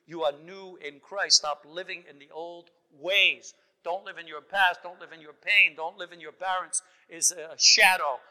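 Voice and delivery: full-force preaching. Acted. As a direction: drive the congregation to the altar call. Sermon climax at full force.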